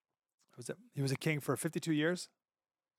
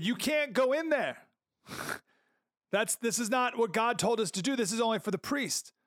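priest objecting; 8 semitones higher than usual. Retold or imitated. Priests warning the king at the temple door, high and pleading. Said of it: "and said".

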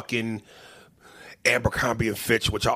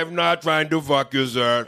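put-on Indian scam-caller accent, higher and louder; 4 semitones higher, 4 dB louder than usual. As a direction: imitate an Indian phone scammer. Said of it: "and he had the accent".

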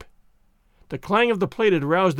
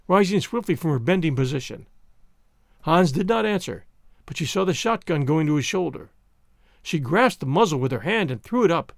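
plain reading voice

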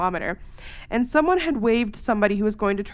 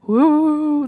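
mock spooky woo; 5 semitones higher, 7 dB louder than usual. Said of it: "Don't be like".

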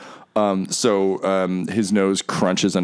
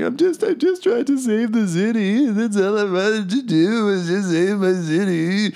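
blubbering; 10 semitones higher than usual. Acted. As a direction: blubber pathetically, stretching the vowels. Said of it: "he's blubbering".

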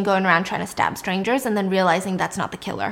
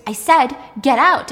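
louder, forceful retold yell; mock scream; 3 semitones higher, 5 dB louder than usual. Ellen orders Hannah out, half-screamed. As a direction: raise the voice without fully screaming. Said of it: "And Ellen screams".

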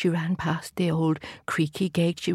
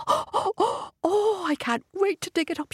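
excited; 11 semitones higher than usual. A narrator's reading. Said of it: "she said".

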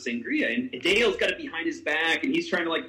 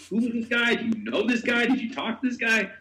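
retold insistent questioning; 7 semitones higher than usual.

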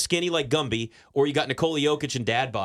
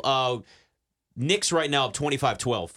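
ordinary talk

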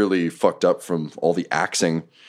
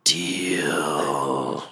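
monster whisper; 3 semitones lower, 4 dB quieter than usual. Acted. Nothic agrees to the bargain.

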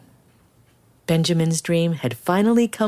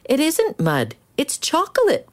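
put-on much higher, insistent boy; a boy insisting it's chocolate; higher, eager protest; 12 semitones higher than usual. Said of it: "protested Benjamin".

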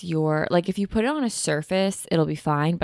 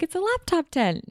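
mom's voice; 12 semitones higher than usual. Retold or imitated. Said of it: "And she's like".

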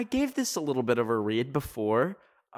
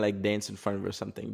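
ordinary talk, nothing marked

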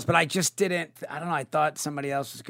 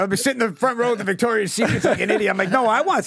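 higher, louder whiny protest; mock whining; 7 semitones higher, 4 dB louder, faster than usual. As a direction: whine like a spoiled kid. Mock-whining about plane tickets.

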